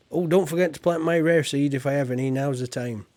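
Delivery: normal talking voice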